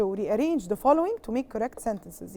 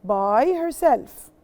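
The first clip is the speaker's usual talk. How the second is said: "By herself" slow and a bit louder; slow model answer; 4 dB louder, much slower than usual. Shown as a demonstration. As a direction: drill the phrase for students. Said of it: "What do I say?".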